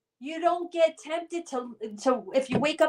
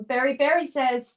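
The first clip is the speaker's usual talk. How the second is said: ordinary delivery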